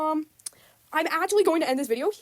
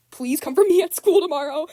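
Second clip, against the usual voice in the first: laughing, louder plea; 4 semitones higher, 5 dB louder than usual. Real, not acted. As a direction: beg the friends through real laughter.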